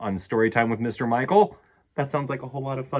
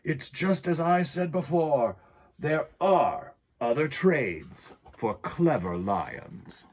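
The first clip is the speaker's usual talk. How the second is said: put-on happy lion with a higher French-accented voice; 5 semitones higher, slower than usual. A narrator's reading. Happy lion speaking of his gardener son, French accent. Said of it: "I apologize for my French accent".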